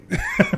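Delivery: giggles in a high squeal; high squeal of laughter. Real, not acted. Cracking up at the Denny's joke, squealing laugh.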